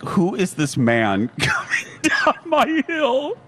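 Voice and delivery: slow, high mock alarm; hammed-up dread dissolving into laughter; imitating alarmed friend. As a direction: draw it out and crack up laughing. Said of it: "it was just like".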